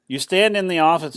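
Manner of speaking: usual speaking voice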